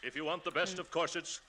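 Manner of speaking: ordinary talk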